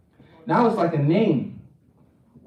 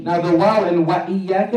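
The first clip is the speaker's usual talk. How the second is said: normal talking voice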